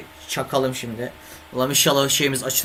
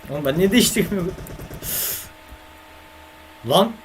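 frustrated outburst; 5 semitones higher than usual. Real, not acted. Streamer yelling at his own game, exasperated outburst. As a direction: blurt it out, fed up with yourself.

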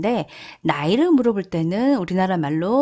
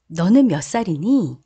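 ordinary talk, nothing marked

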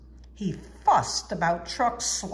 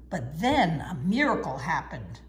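plain reading voice

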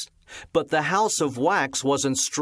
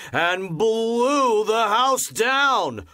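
rising higher, slow emphasis; dramatic blow; 8 semitones higher, slower than usual. A narrator's reading. Narrator building to the house falling. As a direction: make the collapse big.

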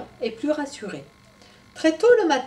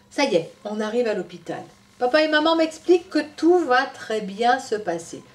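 put-on lively storytelling voice for children; story narration; 4 semitones higher, 5 dB louder than usual. A narrator's reading. Storyteller telling Tom's story to children, animated. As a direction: read it to little ones.

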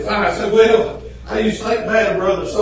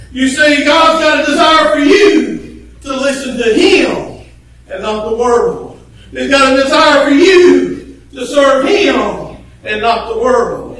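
louder, rhythmic preaching with uh fillers; fiery preaching; 4 semitones higher, 6 dB louder than usual. Acted. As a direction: preach it to the congregation, half-singing. Preaching at full force.

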